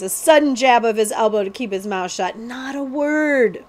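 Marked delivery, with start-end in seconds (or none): 2.4-3.6 hushing warning. A narrator's reading. Jemmy warns prince to keep quiet.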